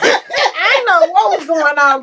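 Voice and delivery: slow, high, loud laughing admission; real laughter. Real, not acted. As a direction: laugh through it. Laughing at her own story.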